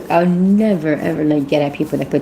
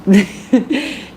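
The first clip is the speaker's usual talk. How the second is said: burst of laughter; 6 semitones higher than usual. Real, not acted. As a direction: crack up laughing. Laughs at own impression.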